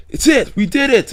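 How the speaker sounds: thrilled victory shout; excited Kenny. Retold or imitated. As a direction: cheer it like you finally made it. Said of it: "Kenny's like".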